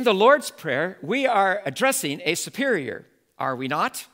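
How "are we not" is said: ordinary talk, nothing marked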